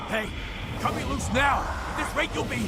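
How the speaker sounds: high, loud, frantic yell; struggling to break free, shouting; panicked shout. Acted. Noah grabbed, yelling to be cut loose, panicked shout. Noah seized, demands release.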